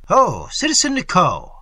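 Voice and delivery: loud hail across the hall; calling out loud. A narrator's reading. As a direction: shout his name to get attention. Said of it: "cried a Jacobin".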